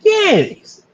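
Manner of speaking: very high, loud squeaky yes; imitating the fan's high excited yes; eager fan. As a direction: blurt it out like a starstruck fan.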